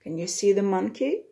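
ordinary delivery

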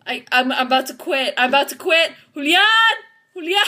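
loud, stammering alarm; panicked. Real, not acted. Player about to quit calls Julian, loud panic. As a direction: call out loudly, on the edge of quitting.

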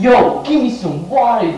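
put-on loud demand. Another put-on attempt at machine.

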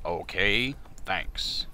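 soft, low Morgan Freeman; quiet deep thanks. Pretend. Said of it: "I'll Morgan Freeman it as the guy".

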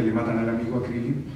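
usual speaking voice

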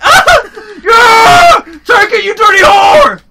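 high, loud victory yell; gloating scream at a friend; triumphant shout. Real, not acted. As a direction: roar it out after winning. Scoring against friend in game.

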